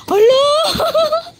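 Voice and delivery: high, loud laughing shout of delight; excited laughing call. Real, not acted. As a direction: cheer as the ball soars. Ball flies high, laughing.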